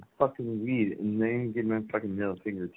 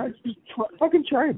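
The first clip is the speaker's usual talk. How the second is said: pushy urging; 14 semitones higher, 4 dB louder, faster than usual. Real, not acted. Guest urging host to try.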